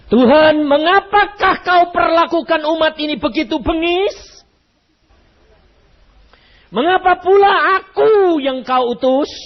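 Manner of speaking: high, loud complaint to God; pleading protest. Acted.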